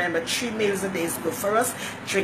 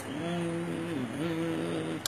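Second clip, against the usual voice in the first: soft, sustained closed-mouth "mmm"; drawn-out mm; 8 dB quieter than usual. Shown as a demonstration. Showing how Facebook catches her mid-talk, drawn-out soft mm. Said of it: "like when you're talking and like this".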